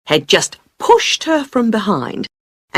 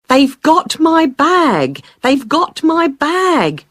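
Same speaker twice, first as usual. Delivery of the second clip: high, drawn-out cry of alarm, mildly raised; retold shout; 9 semitones higher, slower than usual. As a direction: call out slowly and high, imitating a shouting woman. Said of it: "she was shouting".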